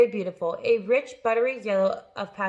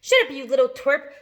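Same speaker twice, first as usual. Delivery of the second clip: put-on loud, sharp snap at the boy; angry snap; 3 semitones higher, 6 dB louder than usual. A narrator's reading. Aunt snaps at James, loud and sharp. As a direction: cut him down. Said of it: "Aunt Spiker snapped".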